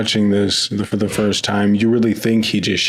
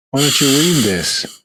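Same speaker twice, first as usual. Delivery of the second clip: higher, mock-taunting quote; 5 semitones higher than usual. Magneto taunting Patrick Stewart's character about his helmet, taunting. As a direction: say it like a verbal middle finger. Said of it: "kinda like a middle finger".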